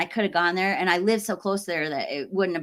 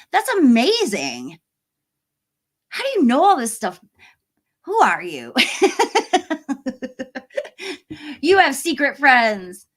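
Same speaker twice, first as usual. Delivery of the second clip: delighted amazement; 6 semitones higher, 5 dB louder than usual. Real, not acted. Amazed by a viewer's trivia.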